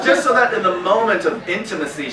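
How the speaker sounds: ordinary talk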